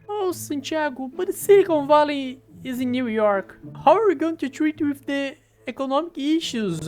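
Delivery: high, pleading advisor voice; worried advisor voice. Acted. Advisor pleading with the president, high and worried.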